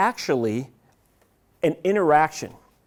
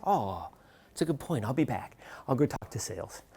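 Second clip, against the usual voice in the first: meek backing down; 3 semitones lower, 10 dB quieter than usual. Retold or imitated. Sheepish reply to marketing.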